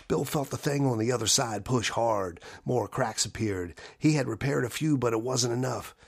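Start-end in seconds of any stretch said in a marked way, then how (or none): none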